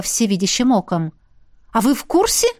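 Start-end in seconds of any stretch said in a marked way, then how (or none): 1.7-2.5 exclaimed. Acted. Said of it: "воскликнула я".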